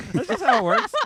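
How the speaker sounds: very high, very loud outburst; exasperated shout. Real, not acted. Streamer ranting about studio deadlines, exasperated shout. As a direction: burst out at the top of your voice.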